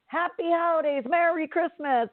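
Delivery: high, sing-out holiday greeting; cheerful greeting. Retold or imitated. Customer hailing the waiting line, cheerfully. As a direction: call out season's greetings in a lifted, cheery voice.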